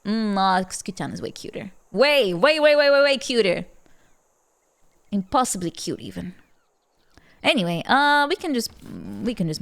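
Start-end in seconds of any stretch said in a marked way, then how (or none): 1.9-3.7 louder gushing over cuteness; excited gushing. Real, not acted. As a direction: gush about how cute. Gushing over cute character.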